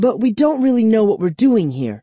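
high, fast correction; rushed, high-pitched clarification; correcting. A narrator's reading. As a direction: put them right quickly. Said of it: "Kara corrected me".

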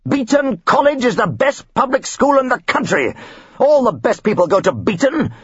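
hammed-up outraged snob; ranting father. Father defending his old school, ranting.

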